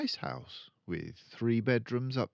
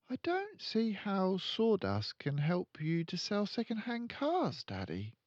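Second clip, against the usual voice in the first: put-on much higher, puzzled child voice; little girl; 12 semitones higher than usual. Matilda questioning her father, puzzled child voice. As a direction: wonder aloud like a clever girl. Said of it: "Matilda speaking".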